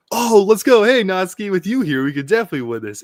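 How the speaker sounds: loud, high hyped-up imitation; acted gung-ho cheer, rushing at the end; excited rallying. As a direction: burst out like an overeager teammate. Voicing eager character rallying his partner.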